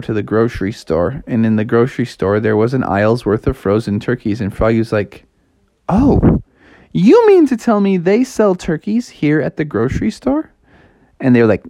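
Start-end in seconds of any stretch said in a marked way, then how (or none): 5.9-10.5 high, astonished question; amazed, pitched well up; incredulous. Acted. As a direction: ask it in rising disbelief. Frog amazed at store turkeys.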